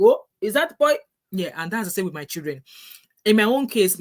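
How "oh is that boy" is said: high, louder mock double take; surprised. Listener realising the speaker is Nigerian, mock surprise. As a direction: act out a startled "Oh, is that boy?". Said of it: "you're like".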